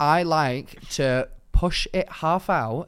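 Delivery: drawn out, very slow quote of the coworker; slow retold confession. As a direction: stretch each word slowly as if confessing. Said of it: "she genuinely was like".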